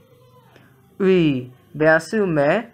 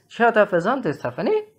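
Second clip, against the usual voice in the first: ordinary talk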